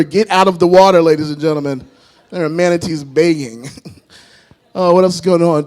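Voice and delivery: ordinary talk, nothing marked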